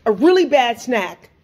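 usual speaking voice

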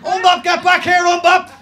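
very high, very loud yell; shout. Retold or imitated. Older boys shouting after him in the corridor, loud yell. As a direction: bellow the nickname down the corridor. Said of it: "I just hear".

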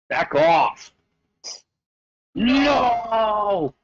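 shouting back off in panic; frantic shout. Real, not acted. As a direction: yell it out, startled and desperate. Attacked in game, yelling at enemies.

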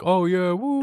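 high, whooping fake celebration; mock cheer. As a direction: cheer like a hustler pretending to win. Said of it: "They're like".

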